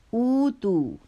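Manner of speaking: اوتو with the first oo drawn out long; long first sound. Shown as a demonstration. Teacher pointing students to the long first sound in اوتو. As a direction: stretch the opening oo of اوتو. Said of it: "پہلی sound لمبی ہے نا".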